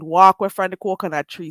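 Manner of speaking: firm Jamaican Patois, low and even. Shown as a demonstration. Streamer warning off accent critics, firm Jamaican Patois. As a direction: warn them off in Jamaican Patois. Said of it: "should I say it in a, another way".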